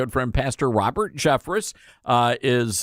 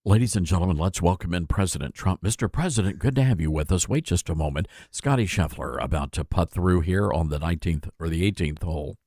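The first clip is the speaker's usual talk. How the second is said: low, measured golf announcer voice; golf commentator; 9 semitones lower than usual. Pretend. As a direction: call it like a golf broadcaster on the green. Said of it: "talk in a golf voice".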